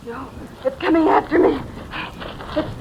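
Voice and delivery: ordinary delivery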